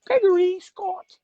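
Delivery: high, slow mock mother calling his name; mom calling. Son mimics his mother calling his full name, high and slow. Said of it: "my mom only knew me as".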